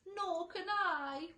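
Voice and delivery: slow high wail. A narrator's reading. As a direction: wail it out miserably. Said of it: "wailed the glowworm".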